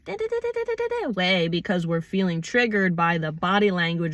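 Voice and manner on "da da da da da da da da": high-pitched rattled-off da-da-da; reactive tone. Shown as a demonstration. Showing how tone comes out when triggered, reactive. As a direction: mimic a triggered, reactive burst. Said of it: "tone and inflection can come out in a reactive".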